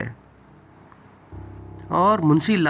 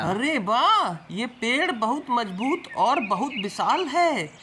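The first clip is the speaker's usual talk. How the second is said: pleased woodcutter; 9 semitones higher than usual. Acted. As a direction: admire the big tree in a raised voice. Woodcutter admires tree.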